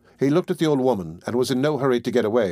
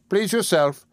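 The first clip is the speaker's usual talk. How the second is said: put-on higher, stern voice of a grasping old woman; old pawnbroker; 8 semitones higher, slower than usual. A narrator's reading. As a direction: lay down the terms like a tight-fisted old moneylender. Old pawnbroker dismissing him.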